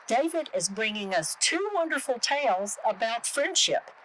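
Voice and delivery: ordinary delivery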